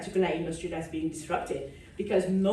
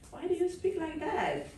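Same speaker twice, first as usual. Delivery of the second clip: put-on higher, quizzical stranger asking about her accent; curious stranger; 10 semitones higher, 5 dB quieter than usual. A South African stranger quizzing the speaker about her accent, curious and higher. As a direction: play a puzzled local asking why she sounds different. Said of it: "before someone asks me".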